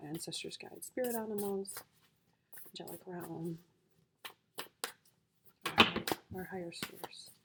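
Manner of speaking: soft, reverent calling of the guides; quiet summoning of spirits; hushed invocation. Real, not acted. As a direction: murmur the names like a prayer.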